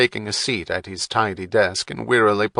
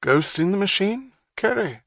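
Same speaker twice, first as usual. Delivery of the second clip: much higher, lightly teasing; tentative quip; 11 semitones higher than usual. A narrator's reading. Saint John teasing Wapner, tentative quip. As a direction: venture it gently. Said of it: "he ventured".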